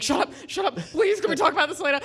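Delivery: high, loud shout at mom; frantic shout. Retold or imitated. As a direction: snap at mom mid-cutscene. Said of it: "I was just like".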